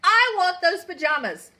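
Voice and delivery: loud, high furious demand; angry demand. Acted. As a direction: order the guards in a rage. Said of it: "she told the guards".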